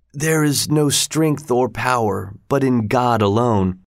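plain reading voice

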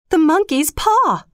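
high, excited exclamation; wild cry. A narrator's reading. Wife remembering the paw in the night, crying wildly. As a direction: call it out with pitch leaping. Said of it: "she cried wildly".

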